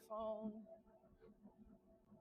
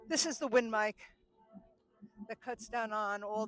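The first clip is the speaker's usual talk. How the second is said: louder so everyone hears; 8 dB louder than usual. Shown as a demonstration. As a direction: project the voice louder. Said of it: "I do have to just talk a little bit louder".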